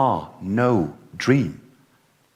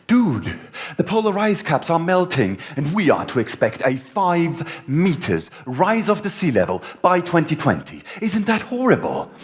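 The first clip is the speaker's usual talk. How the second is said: lightly voiced woman, higher; a hint of an earnest woman, pitched up; imitating her; 7 semitones higher than usual. Retelling the woman at the bar, lightly voiced higher. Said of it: "she said".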